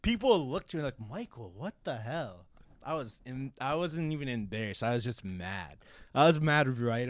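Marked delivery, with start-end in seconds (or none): 1.0-2.5 low, soft imitation of a scolding; hushed coworker reproach. Coworkers reacting to Michael, hushed and reproachful. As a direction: say it under the voice, appalled. Voicing shocked coworkers staring at him.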